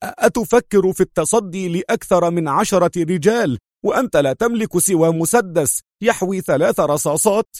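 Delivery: high, heated incredulous challenge; sharp. A narrator's reading. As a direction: challenge him sharply, stammering at first. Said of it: "مستطردا في حدة".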